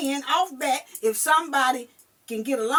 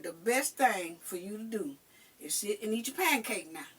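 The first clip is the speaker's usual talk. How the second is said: low warning; 5 semitones lower, 9 dB quieter than usual. Retold or imitated. Mother threatening the man.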